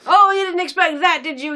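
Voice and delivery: high, loud smug taunt; mocking taunt. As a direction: sneer like a smug filmmaker. Mocking the filmmakers.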